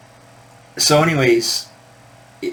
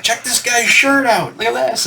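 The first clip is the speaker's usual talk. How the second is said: 1 excited band member; 11 semitones higher than usual. Retold or imitated. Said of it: "the band's like".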